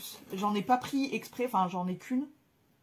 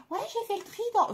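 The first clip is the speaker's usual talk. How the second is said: mock subscriber message; 13 semitones higher, faster than usual. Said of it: "m'envoient souvent des".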